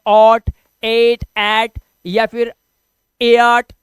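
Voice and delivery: "ought, ate, at, ए आर्ट" loud, slow, each vowel exaggerated; wrong readings of art. Shown as a demonstration. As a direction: list misreadings "ought", "ate", "at", "ए आर्ट" with heavy spacing. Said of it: "नहीं पढ़ सकते".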